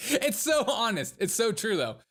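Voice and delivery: high, loud, laughing outburst; laughing agreement. Real, not acted. Streamer reacting to a brutally honest clip, laughing. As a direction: crack up while agreeing, pitch shooting up.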